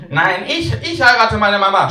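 high, loud rival yell; a second boy outshouting the first; shouting child. Acted. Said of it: "schreit Torben".

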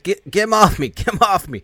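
high, loud acted struggle; panicked cry. Man tackled by a zombie calling for help, acted panic. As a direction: yelp for help in mock panic.

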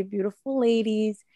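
usual speaking voice

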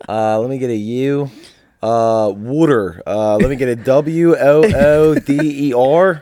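drawn-out Philly spelling; slow, loud mock Philly contestant; Philly accent.

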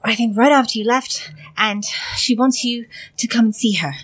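breathless, urgent messenger voice; urgent panting report. Acted. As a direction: rush it out between breaths. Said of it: "Breathe, breathe, darling".

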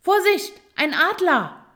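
high, loud cry of alarm; urgent, high-pitched shout; alarmed scream. A narrator's reading. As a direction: shriek a warning of danger. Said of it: "schrie dabei".

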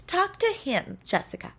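patronizing, rising up high on talk; condescending director. Retold or imitated. Director patronizing the actress over the talkback, condescending. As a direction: talk down to her like a child. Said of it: "So condescending".

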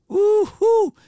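high, loud whooping call; excited holler. Acted. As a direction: whoop it out, hyped. Hollering at a woman walking by.

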